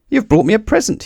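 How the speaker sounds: high, delighted voice; thrilled at the gift; Mister Wrong. A narrator's reading. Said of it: "he said".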